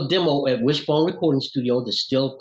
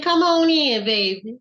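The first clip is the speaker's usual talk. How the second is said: put-on old lady voice; 12 semitones higher, slower than usual. Old lady welcoming them.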